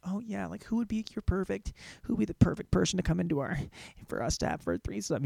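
soft imitation of a woman's dirty talk; imitated girlfriend. Girlfriend texting him about a threesome, softly imitated. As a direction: quietly play the girlfriend musing aloud. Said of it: "She's like".

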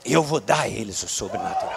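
lightly voiced inner voice of the Spirit; preacher voices God speaking to his spirit, low and solemn. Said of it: "ele tá dizendo ao meu espírito aqui".

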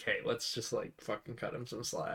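secretive low murmur between cops; whisper. Retold or imitated. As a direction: murmur it privately to your partner. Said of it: "what they whisper to each other".

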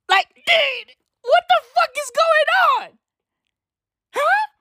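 high-pitched "what the fuck"; squeaky disbelief. Real, not acted. Incredulous outburst.

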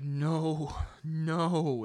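high, loud, shocked refusal; offended girl. Retold or imitated. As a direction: mimic an offended stranger recoiling. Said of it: "And she was like".

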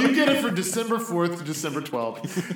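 giggling through a pitch; laughing sales pitch. Acted. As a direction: sell it while cracking up.

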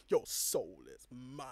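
low, slow menacing villain. Retold or imitated. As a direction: growl it slowly like a villain. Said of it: "standing up and being like".

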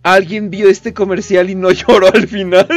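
high, loud, laughing rant; exaggerated outrage. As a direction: rant in mock horror, loudly. Mock outrage at sad ad. (indignación exagerada entre risas)

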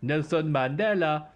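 slow, drawn-out mock South African accent. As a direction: draw the name out in South African. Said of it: "That's my South African fella".

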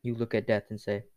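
ordinary delivery

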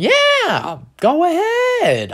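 very high, drawn-out yes; exaggerated, stretched-out go-ahead; emphatic consent. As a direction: squeal it as if it's obvious. Acted easygoing answer to a friend.

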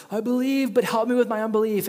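high, loud, fast desperate plea; desperate cry. Acted. Desperate father cries out to Jesus for help. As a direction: cry out to be helped.

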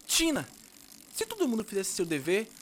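put-on high, fast young boy voice; chatty kid explaining. Child character Guga talking.

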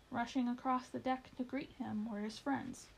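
plain reading voice

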